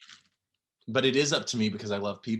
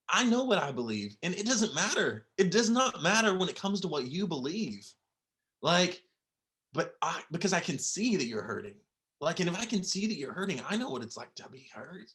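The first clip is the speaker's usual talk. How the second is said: tender comfort; 6 semitones higher than usual. Retold or imitated. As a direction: gently coax someone out of their hurt. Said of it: "if the world just went".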